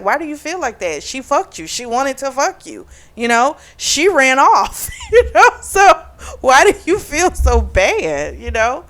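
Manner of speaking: high, incredulous and a little laughing; incredulous rant. Retold or imitated. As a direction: push back at him in disbelief. Arguing with the hero in her head.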